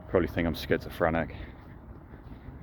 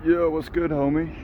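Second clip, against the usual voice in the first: bro greeting; 10 semitones higher than usual. Pretend. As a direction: greet the ducks like an old homie. Greeting the ducks in a voice.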